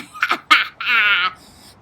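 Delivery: a gleeful laugh in the narration. A narrator's reading. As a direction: sly laugh mid-sentence.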